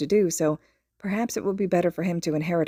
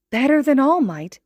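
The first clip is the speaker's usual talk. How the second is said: much higher, louder amazed question; awed; 7 semitones higher, 7 dB louder than usual. A narrator's reading. Little boy asks his friend in awe. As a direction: gasp it in wonder. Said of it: "he asks in awe".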